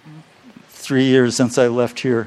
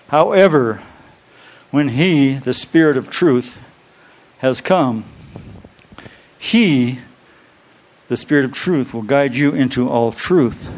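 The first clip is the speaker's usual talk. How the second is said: scripture reading; 3 dB louder than usual. Acted. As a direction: read each phrase out to the church. Reads scripture aloud to congregation.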